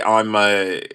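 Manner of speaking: ordinary talk, nothing marked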